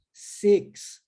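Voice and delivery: "six" said as "seks" with an open e; "six" como "seks"; six with e sound. Shown as a demonstration. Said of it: "Olha a diferença".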